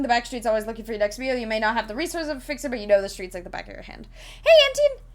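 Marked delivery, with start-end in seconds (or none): 4.4-5.0 high, loud hello to a newcomer; excited greeting. Real, not acted. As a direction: call out a delighted welcome. Greets a viewer who arrives.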